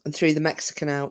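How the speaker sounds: usual speaking voice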